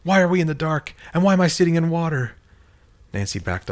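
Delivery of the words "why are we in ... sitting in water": high, loud, fast bewildered questioning; confused demand. Acted. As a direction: demand to know what happened. Confused friend demanding answers.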